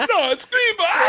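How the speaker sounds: high squeal, crumbling into laughter; laughing retold quote. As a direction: repeat the punchline through hysterics. Repeats punchline in hysterics.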